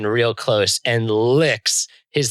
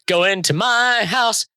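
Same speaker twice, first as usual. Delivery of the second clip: high bossy command; 10 semitones higher than usual. Acted. Barking order at the lich.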